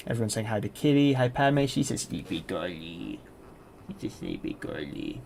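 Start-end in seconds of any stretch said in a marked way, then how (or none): 1.6-3.2 put-on soft, slow, lower baby talk. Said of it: "I shouldn't be doing my baby voice". 4.0-5.2 put-on breathy whispered baby talk. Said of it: "I shouldn't be doing my baby voice".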